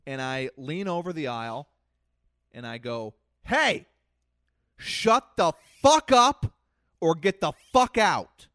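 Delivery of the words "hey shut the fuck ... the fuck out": loud furious yell, high and slow; screaming at kids. Retold or imitated. Yelling at noisy kids in theater.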